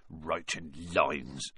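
"lines" low, soft, slow and scornful; mock his "lines" with a sneer; scornful echo. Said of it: "Hagrid growled".

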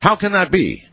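high-pitched, mock-outraged complaint. A narrator's reading. Husband accusing wife over breeding lizards, mock outrage. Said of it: "I was equally outraged".

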